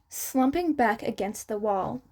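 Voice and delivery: ordinary delivery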